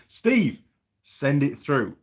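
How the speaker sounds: calling out. Real, not acted. Calling out to a viewer.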